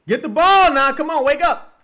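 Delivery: loud, high urgent shout at the team; real yelling at the screen. Real, not acted. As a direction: bark them awake. Yelling at players on TV.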